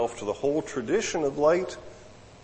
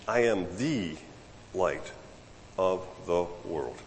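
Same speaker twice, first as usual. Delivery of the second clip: "the" as a long "thee", then "light" after a pause, low and soft; measured, hushed declaration leaning on "the"; weighty stress on THE; 5 semitones lower, 7 dB quieter than usual. Retold or imitated. Solemn restatement after rejected versions.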